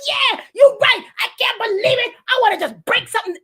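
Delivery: high, loud burst of riled-up agreement; hammed-up, high-pitched outrage speeding up; acted fury. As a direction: get carried away venting. Acting herself getting riled up.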